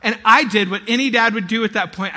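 usual speaking voice

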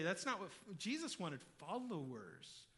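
usual speaking voice

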